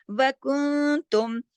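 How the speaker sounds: slow, drawn-out Quran recitation; chanting the verse as a teacher's model; tajweed recitation. Shown as a demonstration. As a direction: recite it slowly with careful tajweed. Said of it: "यहाँ मैंने इख्फा किया".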